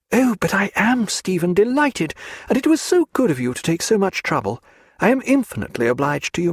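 a high, quick society lady voice; a gentlewoman delighted to escape the house; Diana, lively and high. A narrator's reading. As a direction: play the restless young woman, eager. Diana's voice, read by the narrator.

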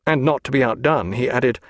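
usual speaking voice

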